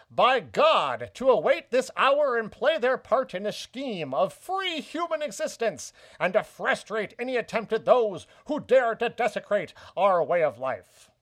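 put-on grand old-newsreel mid-Atlantic accent. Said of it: "I'll go back into the accent here".

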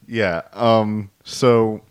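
ordinary delivery

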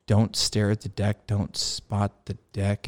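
slow, measured repetition to oneself; pondering the advice. Retold or imitated. Pilot mulls over debrief advice, slowly pondering. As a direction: chew over each word of the instruction. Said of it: "had a little, you know, come to Jesus".

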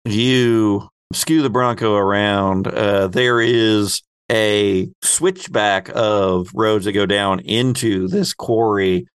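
ordinary talk, nothing marked

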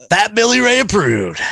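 high, swooping announcer tagline; catchphrase stamp. Pretend. Host showing off the approval stamp, exaggerated high announcer voice.

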